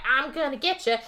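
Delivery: a hint of taunting menace; crazy attacker. Crazy chick coming up to her victim, lightly mocked menace. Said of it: "the crazy chick comes up to her".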